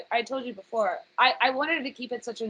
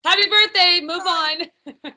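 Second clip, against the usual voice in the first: higher, louder joking call-out; playful raised-voice greeting; mock birthday shout; 6 semitones higher, 6 dB louder, slower than usual. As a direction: call out a hurried greeting for laughs. Mock rushed birthday greeting to family.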